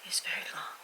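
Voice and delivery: hushed deadpan whisper; whispered verdict. Shown as a demonstration. Whispering her book review to viewers, dry deadpan. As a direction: breathe it out like a secret. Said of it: "That's my official feedback".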